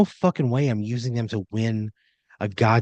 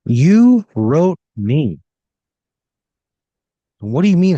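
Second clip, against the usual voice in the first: louder, slow and baffled; indignant protest; 4 semitones higher, 7 dB louder, slower than usual. Acted. Player answering Eddie English on the phone, loud and indignant. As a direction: push back, drawn out and loud.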